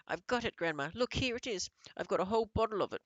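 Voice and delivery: high, loud, racing at the end; a boy showing off his prize to grandmother; excited child. A narrator's reading. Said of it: "I cried, jumping up and down".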